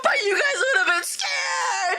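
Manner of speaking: high, drawn-out wail; hammed-up tearful squeak; whining panicked friend. Panicking friend's retold answer.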